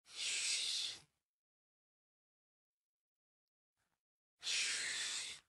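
whispered shush to silence them; hushing whisper. Acted. Howdy hushing the screaming neighbour in a whisper.

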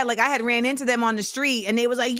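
ordinary talk

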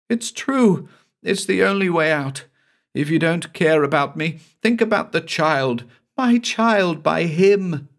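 high pleading woman's voice, slowing on the last words; anguished Cassie. Acted. Cassie urges her lover to think of the child, high anguished plea. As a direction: press the plea, drawing out the horror.

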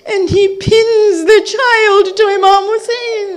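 high, loud grieving sermon, breaking into sobs; rising wailing majlis recitation; tearful preaching lament. Acted. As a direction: preach the tragedy at full force, voice choked with tears. Karbala lament at full force.

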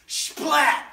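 high, loud burst of impact; loud splat. Acted. Jonah lands on the beach, explosive shouted splat. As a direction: shout it as a sound effect. Imitates Jonah hitting the ground.

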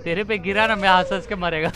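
usual speaking voice

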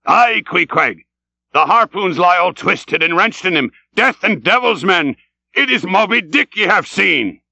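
high, exultant declaration; excited sea captain. Acted. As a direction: confirm it with mounting fervour. Ahab confirms it is Moby Dick.

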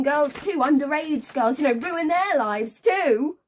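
loud, high-pitched sarcastic tirade; scornful outrage. Acted. Woman mocking his grand new name, bitterly outraged. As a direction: throw the accusation at him with loud contempt.

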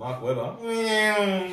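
stretched mock announcer call, high; mock drawn-out call. Teasing his mate with a silly slow high name call. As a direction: draw the name out slowly to tease. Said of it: "Shut the fuck up".

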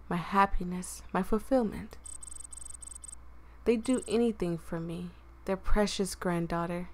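quiet, hushed read of a sad story; soft story narration. Acted. Reads game story narration softly.

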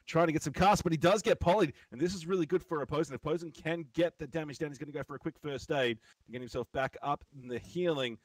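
building from steady commentary to excited shouting; esports caster. Acted. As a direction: ramp up the hype as the duel closes. Play-by-play building to climax.

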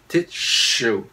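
"tissue" said wrongly as "tit-shoe". Shown as a demonstration. Teacher shows learners the mistake, "tissue" with a wrong shoe ending. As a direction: swap the s in "tissue" for a heavy sh. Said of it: "Lại sai liền".

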